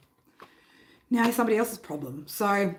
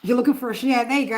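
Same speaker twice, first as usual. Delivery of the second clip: ordinary talk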